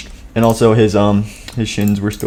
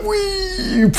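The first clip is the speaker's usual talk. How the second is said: whee; 5 dB quieter than usual. Acted. Flings the doll.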